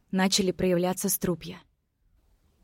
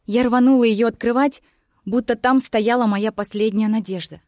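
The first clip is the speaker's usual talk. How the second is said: ordinary delivery, nothing marked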